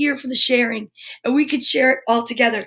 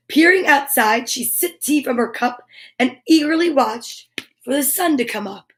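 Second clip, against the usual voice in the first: put-on reading to children. Reading picture book to kids.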